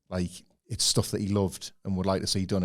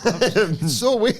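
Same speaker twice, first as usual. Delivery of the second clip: high, loud, laughing exclamation; laughing, incredulous, pitched up; amused disbelief; 10 semitones higher, 8 dB louder, much slower than usual. Real, not acted. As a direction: draw it out slowly through laughter. Laughing at the missing story.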